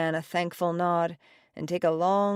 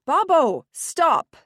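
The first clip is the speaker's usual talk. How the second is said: much higher, louder shout of "Babbo, stop"; 11 semitones higher, 8 dB louder, slower than usual. A narrator's reading. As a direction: yell to cut him off. Daughter shouts, restaurant silent.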